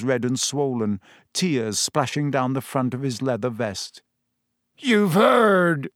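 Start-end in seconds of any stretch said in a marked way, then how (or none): 4.8-5.9 high, loud tearful bellow. A narrator's reading. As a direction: burst out bellowing through tears. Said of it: "he bellowed".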